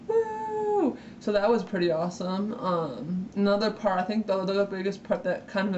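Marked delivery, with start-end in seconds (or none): 0.1-1.0 high, long-held cheer; celebratory high whoop; excited woo. Real, not acted. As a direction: let out a high-pitched fangirl woo. Cheering the memory of the show.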